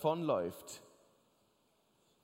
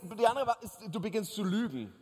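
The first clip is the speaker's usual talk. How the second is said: normal talking voice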